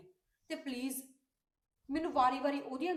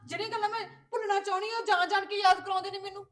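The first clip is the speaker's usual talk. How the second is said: higher and louder, hurt and indignant protest; angry outburst; 8 semitones higher, 9 dB louder than usual. Acted. Sister confronting brother about the sister-in-law's advice, angry hurt outburst. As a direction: let the grievance burst out at him.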